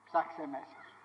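low, soft, inward advice; quiet exhortation. Real, not acted. Preacher telling listeners to look within, quietly. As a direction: say it softly and low. Same exhortation, first softly.

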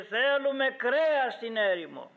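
high-pitched imitation of a clamouring crowd; crowd's cry. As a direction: cry it out like people demanding food. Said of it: "φώναζε και έλεγε".

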